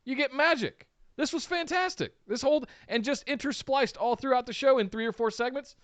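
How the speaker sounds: high, bubbling delight; gushing praise. Real, not acted. Cohost raving to his partner about the skits, high and excited. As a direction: rave about it like a fan. Gushing over the comedy segments.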